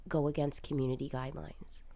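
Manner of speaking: whispered. Retold or imitated. Quoting TikTok's reply, whispered.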